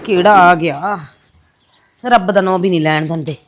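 ordinary talk, nothing marked

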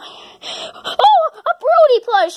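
high, excited outburst; delighted. Acted. Character finding a Brody plush, delighted. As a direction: gush over the new toy.

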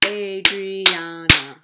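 Adriana slowly in four loud beats, A-dri-a-na; syllable by syllable. Shown as a demonstration. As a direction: punch out each syllable of Adriana. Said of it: "we have four syllable word".

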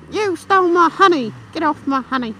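high mock-angry buzzing bee. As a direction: voice the bike as a cross little bee. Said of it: "like a slightly angry bee".

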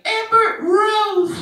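put-on high, slow baby voice with a laugh; baby's sing-song word. Daughter's second try, high slow giggling baby voice. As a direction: drag it out like a giggling toddler.

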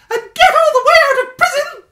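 high, loud squeak with a laugh; bubbling with the discovery; growing excitement. Acted. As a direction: Stuart thinking it through aloud. Stuart working it out.

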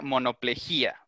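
monoplejía said with the stress on jí, mo-no-ple-JÍ-a; teacher correcting the stress, monoplejía with accent on í. Shown as a demonstration. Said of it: "según yo tiene acento en la, en la I".

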